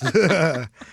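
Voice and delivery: real amused laughing. Real, not acted. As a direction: chuckle at your own punchline. Laughs after own punchline.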